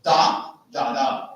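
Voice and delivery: first DA hit, then two lighter ones; dactyl stress pattern. Shown as a demonstration. Teacher sounding out a dactyl, first beat stressed. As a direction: punch the first DA, drop the next two. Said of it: "Next comes tactiles".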